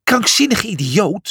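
a lightly acted furious yell; shouting. A narrator's reading. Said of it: "schreeuwde Julius".

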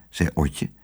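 ordinary delivery

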